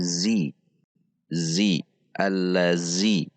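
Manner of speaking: zi with a plain buzzed Z, tongue inside; wrong buzzing Z. Shown as a demonstration. Teacher shows the mistake to avoid, zi with buzzed Z. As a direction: demonstrate the error, allazi with a hard Z sound. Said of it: "Ini yang harus dihindari".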